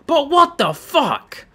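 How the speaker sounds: high, slow, drawn-out exasperation; exasperated outburst. Real, not acted. Gamer after an unlucky loss, exasperated outburst. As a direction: wail it slowly in real frustration.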